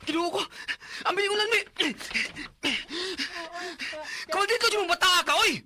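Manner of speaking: high, loud furious scolding; angry scolding. Acted. Parent scolding son for hurting his sibling, furious shouting. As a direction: tear into the naughty boy.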